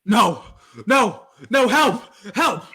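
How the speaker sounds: loud, high fake panic; hammed-up cry for help. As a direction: shout for help like a radio victim. Acted stabbing struggle.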